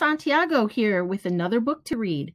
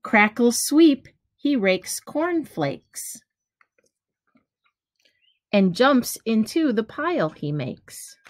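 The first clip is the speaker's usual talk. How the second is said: gentle storybook read-aloud, each rhyme given room; reading to children; slower than usual. Acted. Teacher reading a picture book to kids, lively storytelling. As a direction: read it like bedtime story time for little ones.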